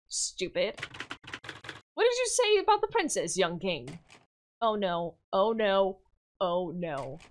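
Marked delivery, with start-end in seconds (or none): 1.9-4.0 put-on much higher, offended girl; indignant princess. Pavla confronting Yuri, much higher indignant girl voice. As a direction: rise up in outrage.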